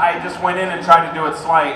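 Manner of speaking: usual speaking voice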